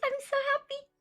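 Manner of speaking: high, bright happy squeal; thrilled about the little root; happy excitement. Real, not acted. Delighted about plant root.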